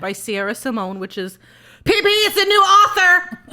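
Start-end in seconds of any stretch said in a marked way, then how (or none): 1.8-3.4 excited. Real, not acted. Excited exclaim with laugh.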